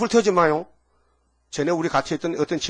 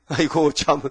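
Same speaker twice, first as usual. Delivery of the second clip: usual speaking voice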